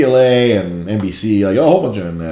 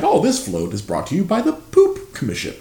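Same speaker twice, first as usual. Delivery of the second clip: high, airy parade announcer voice; mock announcer; 7 semitones higher, 4 dB quieter than usual. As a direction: play the TV announcer for laughs. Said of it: "I'm like".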